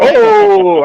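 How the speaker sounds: mock surprise. Acts artist realizing he is naked.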